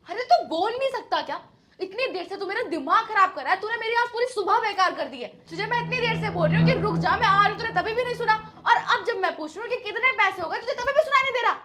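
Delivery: loud, rising anger at someone who won't answer; exasperated housewife berating the delivery man; angry scolding. Acted.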